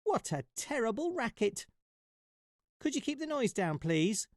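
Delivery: high, wondering mouse squeak; a curious little mouse pipes up; high puzzled mouse voice; squeaky little mouse. Acted. Mouse voice in story.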